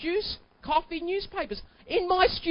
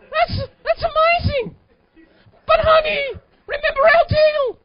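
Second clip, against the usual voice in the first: hammed-up crying plea, much higher and louder; mock anguish; 10 semitones higher, 11 dB louder than usual. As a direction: wail it like a man being robbed, half joking. Husband pleading against the purchase.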